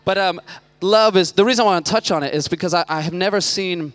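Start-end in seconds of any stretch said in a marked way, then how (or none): none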